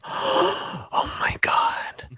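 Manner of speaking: breathless shocked whisper; gasping whisper. Retold or imitated. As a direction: gasp it out in hushed awe. Mocking gasping viewer reaction.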